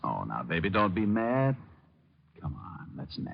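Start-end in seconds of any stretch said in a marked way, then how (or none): 2.4-3.3 coaxing whisper. Acted.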